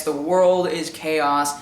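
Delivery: usual speaking voice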